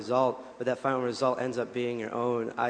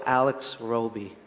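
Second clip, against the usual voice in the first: name said slowly and distinctly; slow down on "Alex Roby" for the listener; slow repeat; slower than usual. Shown as a demonstration. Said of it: "say his name again slowly".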